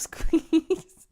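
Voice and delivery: ordinary talk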